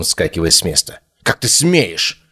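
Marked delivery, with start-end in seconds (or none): 1.2-2.2 high, drawn-out indignation; indignant challenge without shouting; outraged. A narrator's reading. Nairon explodes at Chloe's accusation, outraged. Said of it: "взорвался Найрон".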